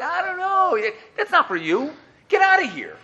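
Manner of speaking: high, slow, laughing shrug; mocking the people who shrug off the question; mock dismissive. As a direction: brush it off with a laugh.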